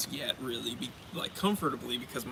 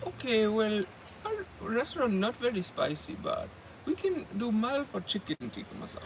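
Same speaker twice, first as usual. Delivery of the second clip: higher-pitched Indian accent impression; put-on Indian accent, pitched up; 7 semitones higher than usual. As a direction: imitate a server with an Indian accent. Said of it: "I'm gonna do the accent".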